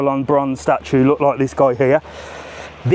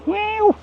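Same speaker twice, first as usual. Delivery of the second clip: much higher playful cat meow; playful high meow for a street cat; mock cat meow. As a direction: miaow at the cat like a kitten.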